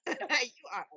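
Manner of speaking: laughing, half-hearted Australian heartthrob; badly faked Australian accent; Chris Hemsworth impression. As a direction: playfully imitate an Australian hunk paying a compliment. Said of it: "In his Australian accent, I can't do".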